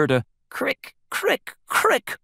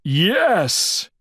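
higher, emphatic yes; exasperated; 7 semitones higher, 4 dB louder than usual. A narrator's reading. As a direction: answer out of patience. Bear answers in exasperation.

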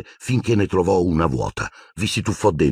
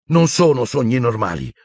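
high, moderately raised outburst; yelled; 7 semitones higher than usual. A narrator's reading. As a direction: snap back in protest, short of a full shout. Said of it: "urlò Harry". (urlo contenuto di protesta)

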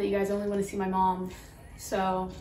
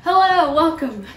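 bright greeting; 8 semitones higher, 13 dB louder than usual. Acted. Cheery vlog intro greeting.

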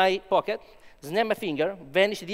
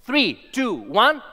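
loud countdown; 6 semitones higher, 7 dB louder, slower than usual. Real, not acted. Countdown for vanishing trick.

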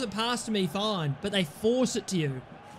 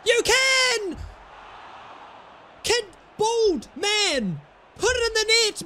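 higher, louder mocking yell at the striker; frustrated shout; 10 semitones higher, 3 dB louder, slower than usual. Real, not acted. Gamer yelling at his bald striker to score, loud. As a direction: bellow it in disbelief.

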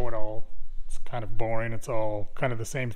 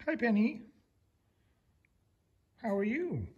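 higher, soft sing-song greeting; doggy baby talk; 7 semitones higher, 7 dB quieter than usual. Acted. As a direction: coo a hello to the puppy. Greeting the dog.

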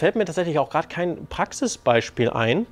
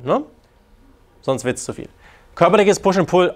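ordinary talk, nothing marked

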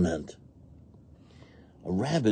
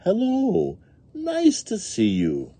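higher drawn-out hello, slowing down; friendly boat greeting; 13 semitones higher, slower than usual. A narrator's reading. Boats replying to each other, drawn-out greeting. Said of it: "the other boats reply as they say".

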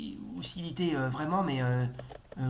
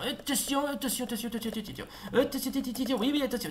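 higher, rapid repeated warnings; frantic warnings; 8 semitones higher, faster than usual. Real, not acted. Gamer dodging enemies, higher frantic repeated warnings. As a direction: warn yourself over and over in panic.

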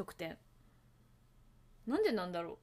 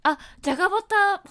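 excited exclamation; 9 semitones higher, 15 dB louder than usual. Real, not acted. Spots another flavour.

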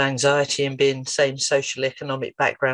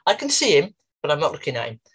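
normal talking voice

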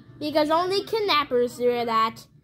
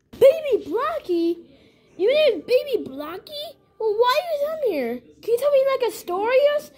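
high-pitched, questioning playmate; high toy character voice; 9 semitones higher than usual. Pretend. Questioning the newcomer Baby Blocky in a high pretend voice. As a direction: pitch it well up, full of questions.

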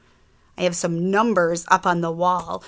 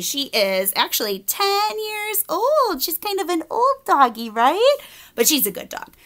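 ordinary delivery, nothing marked

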